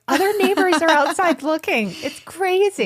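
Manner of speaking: high-pitched, giddy self-quote; excited protest. Retold or imitated. Retold excited plea to family.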